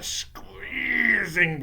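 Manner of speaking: SQUEEZING stretched long and slow; squeezed-out word. A narrator's reading. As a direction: draw out squeezing as if being crushed. Narrator acts out the squeezing.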